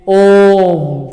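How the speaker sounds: ओम held long, the vowel stretched over three beats; prolonged Om. Shown as a demonstration. Teacher to students showing a pluta vowel: ओम long and held. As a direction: chant ओम slowly, drawing out the ओ. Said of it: "ओम का उच्चारण ... तीन बार उच्चारण".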